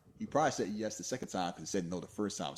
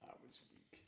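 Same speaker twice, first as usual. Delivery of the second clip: whispered confession; 26 dB quieter than usual. Acted.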